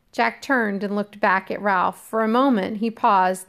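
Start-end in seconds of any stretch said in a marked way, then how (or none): none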